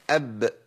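أب with the ب bounced at the end; demo of qalqala on ب. Shown as a demonstration. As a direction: say أب letting the ب echo lightly. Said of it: "نقولهم وحضراتكم يا ريت تقولوا ورايا".

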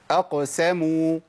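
أقسموا slow with a clear light س after a slight smile; أقسموا stretched, thin light س; correct light sin. Shown as a demonstration. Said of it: "ابتسامة بسيطة بعد القاف". (أقسموا بسين مرققة وابتسامة بسيطة)

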